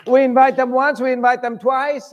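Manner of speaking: loud, high rebuke; full-force preaching. Real, not acted. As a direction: call the church out.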